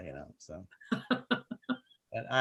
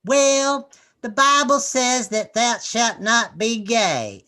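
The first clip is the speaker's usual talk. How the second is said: higher, slow, prim churchy mother; mock Southern Baptist mom; 8 semitones higher, 8 dB louder, much slower than usual. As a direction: lay down scripture with prissy certainty.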